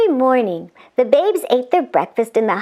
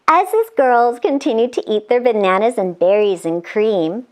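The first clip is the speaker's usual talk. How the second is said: usual speaking voice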